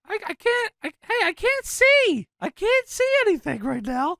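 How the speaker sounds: high-pitched whining complaint; Jerry Lawler. Acted. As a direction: squeal it like an exasperated commentator. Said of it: "Lawler complains about his monitor".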